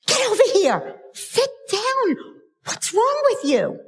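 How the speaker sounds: high-pitched, exasperated mock orders; mock parental scolding. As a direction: imitate a parent snapping at a restless child. Parents scolding children in a restaurant.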